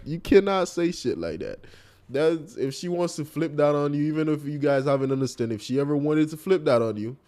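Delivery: normal talking voice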